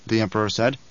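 usual speaking voice

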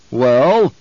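sharp demanding bark. Acted. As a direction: demand an answer loudly. Baron demands answer.

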